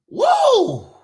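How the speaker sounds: high startled whoa; shocked whoa. Real, not acted. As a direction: gasp it out in shock. Reacting to heating cost.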